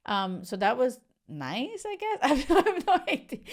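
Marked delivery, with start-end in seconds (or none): 1.9-3.4 laughing through it, higher and louder. Real, not acted. Shrugging off her own point to viewers, laughing.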